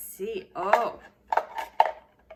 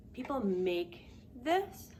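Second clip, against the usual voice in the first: ordinary talk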